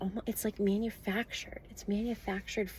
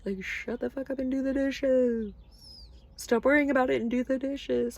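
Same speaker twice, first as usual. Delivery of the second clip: higher, louder, no-nonsense God; blunt God; 5 semitones higher, 7 dB louder than usual. Acted. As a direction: bark the chores like a tough-love parent. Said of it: "God is always like".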